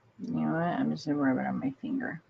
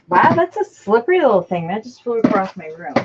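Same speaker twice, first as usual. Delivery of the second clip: high, loud surprised exclaim; excited; 5 semitones higher, 9 dB louder than usual. Real, not acted. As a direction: burst out as the lid pops. Lid finally flies off.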